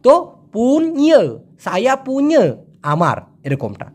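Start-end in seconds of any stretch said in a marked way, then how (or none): 0.5-2.5 slow "পুñe", split as poon-nyuh; drilled colloquial pronunciation. Shown as a demonstration. Teacher drilling learners to repeat it, colloquial pronunciation. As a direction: stretch "পুñe", then put it after "সায়া". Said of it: "বারবার করে উচ্চারণ করবেন".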